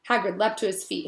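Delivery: plain reading voice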